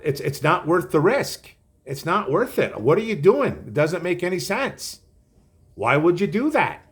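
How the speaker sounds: a lightly voiced send-up of a scoffing pundit; mocking Colin Cowherd. Host mocking pundit Colin Cowherd's scoffing take. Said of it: "Colin Cowherd laughing, saying".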